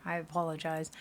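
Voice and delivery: normal talking voice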